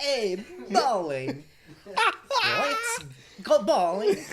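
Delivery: put-on very high, slow, laughing accent; GTA cousin voice. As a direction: stretch it out like the cousin. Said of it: "messaging me every ten minutes going".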